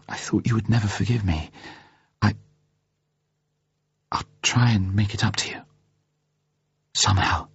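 hushed, halting woman's voice; quiet, tearful promise with broken pauses; grateful, tearful. A narrator's reading. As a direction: falter softly through tears, nearly whispering. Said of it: "gratefully through her tears".

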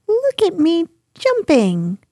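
high, excited little squeak; high-pitched youngster calling for attention; Roo. A narrator's reading. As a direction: squeak it out like a baby animal showing off. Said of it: "squeaked Roo".

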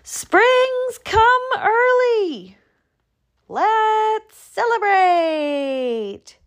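very high, drawn-out yell; long, jubilant high-pitched shout. A narrator's reading. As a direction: proclaim it from a hilltop. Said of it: "He opened his mouth and yelled".

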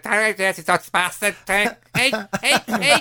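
hammed-up Scouse heckler; Scouse accent. Bloke abusing a Dutch player, hammed-up Scouse accent. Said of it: "I can't even do the Scouse accent anymore".

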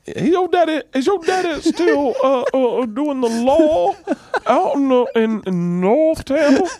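high, slow stammering Southern drawl; rich North Carolina Southerner. Retold or imitated. Buddy's dad asking about daddy's law work, slow Southern accent. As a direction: draw it out like an old North Carolina gentleman, pitched high. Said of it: "That's the interesting accent".

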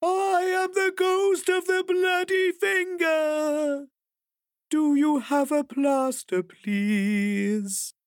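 high slow ghost, turning polite; wail, then ask meekly. Acted. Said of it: "there was a pause".

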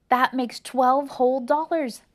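gasp, then loud delighted cry; thrilled at the count; excited cry. A narrator's reading. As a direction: Arthur delighted with his earnings. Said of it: "cried Arthur".

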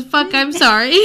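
can't keep it together, laughs the line out; half-laughing read; laughing while reading. Real, not acted. Reading a sobbing apology.

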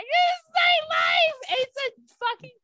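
high, slow mock lament; self-pitying high wail; dramatic wail. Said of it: "I'm like".

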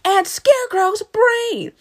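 high, loud drawn-out chorus cry; chiming in. Acted. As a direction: chime in loudly like an eager chorus. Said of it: "both chime together and say".

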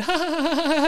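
a real laugh breaking out high; high burst of laughter. Real, not acted. Recalling the guys jumping on the bed, he bursts out laughing. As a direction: cracks up mid-story.

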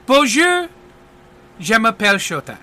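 put-on theatrical French accent, lifted on the greeting; French-accented magician's greeting; French showman. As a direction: introduce yourself as a French stage magician. Magician introduces himself in French.

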